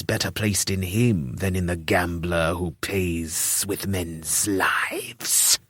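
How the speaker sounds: slow, soft, ominous lisp; whispering lisping priest. Acted. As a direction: whisper it like a zealot. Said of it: "His voice".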